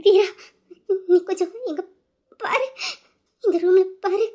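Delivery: halting words between sobs; sobbing. Acted. Sobbing sister sending Veera to look in the room. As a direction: struggle to speak through tears.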